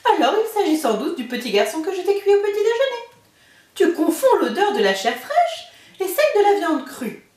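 put-on the giant's wife hurrying the boy away; ogre's wife warning. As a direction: shoo the child away with a nervous chuckle. Wife fooling the ogre.

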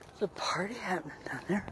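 secretive whisper about the party; quiet aside whisper. Real, not acted. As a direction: whisper it like gossip. Spotting party below.

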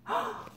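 high soft shocked gasp; startled intake of breath; mock gasp. A narrator's reading. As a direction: gasp in pretend horror. Reader gasps at the swallowing.

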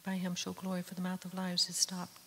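usual speaking voice

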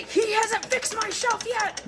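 high, drawn-out angry rant; mock angry venting. Wife venting at porridge about her husband, mock angry. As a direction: pitch it way up and stretch each word in pretend fury. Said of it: "Be angry with the porch. Get out all your frustrations with your husband".

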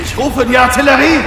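loud, fast battle cry; shouted order. Acted. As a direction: yell the order over gunfire. Soldier calling in artillery in battle. (gebrüllter Befehl)

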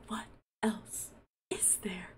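very soft, dropping low at the end; hushed blank reply. Acted. Suitor answering Jasmine's question about liking her, hushed. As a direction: say it small and hushed.

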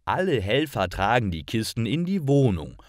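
ordinary delivery

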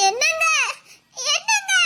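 wife crying after her husband as he walks away. Acted. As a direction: call him back through sobs. Wife calls after leaving husband.

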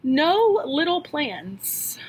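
slow, weighty and deliberate; a teacher's slow solemn advice; slow recited quote. Retold or imitated. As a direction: lay each word down slowly, like a maxim. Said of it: "She said".

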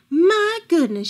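head-shaking dismay. A narrator's reading. Old woman inspects the room, tutting in dismay. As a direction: shake your head at the sight. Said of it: "with a shake of her head".

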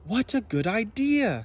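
grateful and keen; Zog pleased. A narrator's reading. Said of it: "said Zog".

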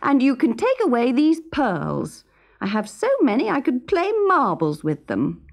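high, spoiled and irritated; cross princess. A narrator's reading. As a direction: snub the gifts like a bored princess. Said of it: "said the princess crossly".

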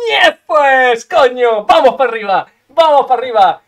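loud victory yells; triumphant shout. Real, not acted. Gamer celebrating a win, loud triumphant shouting. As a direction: bellow it out in triumph.

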